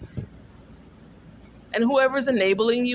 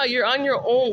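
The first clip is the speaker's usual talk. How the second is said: ordinary talk, nothing marked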